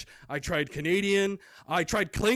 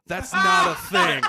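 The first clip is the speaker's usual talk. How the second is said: loud, high punchline yell; exasperated shout; 12 semitones higher, 9 dB louder than usual. Acted. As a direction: blurt it out in mock outrage. Exasperated retort to smokers, punchline.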